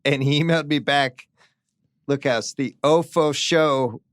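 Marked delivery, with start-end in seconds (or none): 2.1-4.0 slow puzzled read. Retold or imitated. Reading son's garbled reply.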